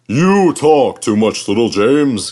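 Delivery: slow, loud, condescending; condescending Gordon. A narrator's reading. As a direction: talk down to the little engine. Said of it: "Gordon would say".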